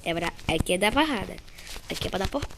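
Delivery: ordinary talk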